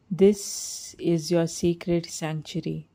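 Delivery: ordinary delivery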